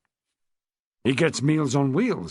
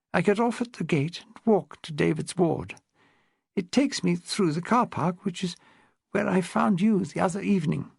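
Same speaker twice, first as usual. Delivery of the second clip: put-on higher, refined elderly upper-class voice; posh old lady; 5 semitones higher than usual. Old lady tells Rose about her husband, posh elderly voice. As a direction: speak like a frail, well-bred widow of seventy.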